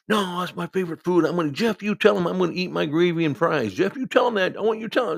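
high, loud whiny protest; indignant roommate demanding backup; getting mad. Retold or imitated. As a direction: imitate an angry patient pleading with his roommate. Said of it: "he just starts getting mad".

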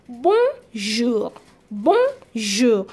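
"bonjour" in two parts, bon, then jour without R; French pronunciation broken down. Shown as a demonstration. Teacher joining the two sounds for learners, syllable by syllable. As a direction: say bonjour slowly as "bon... zhoo". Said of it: "that is why we have the word".

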